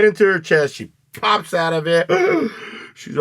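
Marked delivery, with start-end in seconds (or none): 2.1-2.9 high, sharp revival gasp. Retold or imitated. Mia jolting awake from the adrenaline shot, gasping. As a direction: suck in air like waking from death.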